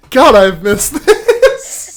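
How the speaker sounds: slow, laughing, fond. Real, not acted. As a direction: laugh it out slowly. Happy laugh with co-host.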